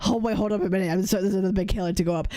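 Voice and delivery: puffing between phrases like a breathless walking streamer; mock panting. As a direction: pretend to be winded from climbing a hill. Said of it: "Do you wanna hear me panting".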